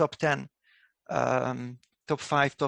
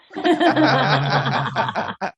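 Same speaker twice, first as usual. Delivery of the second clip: laughing; 8 semitones higher, 8 dB louder than usual. Real, not acted. Laughs at Jared's remark.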